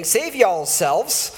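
a light, playful Southern drawl on every y'all. Shown as a demonstration. Pastor rereading Acts to his congregation in a hint of Southern drawl. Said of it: "I'd like to read it in the southern drawl".

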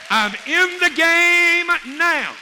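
very high, loud, drawn-out holler; full-force exultant shout. Real, not acted. Preacher rousing congregation to join in, exultant shout. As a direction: belt it out slowly to the whole crowd.

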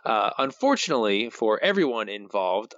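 ordinary talk

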